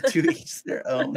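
laughing while saying it. Real, not acted. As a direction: say it through a laugh. Echoing a friend's odd remark.

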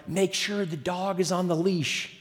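softened voice, as if not to be noticed; hushed urgent warning. Retold or imitated. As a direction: re-enact a careful, quiet instruction. Tense instruction while backing from moose.

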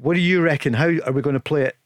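usual speaking voice

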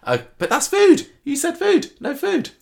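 real, playful outrage; exasperated protest. Real, not acted. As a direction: protest in disbelief. Objecting to a food wish.

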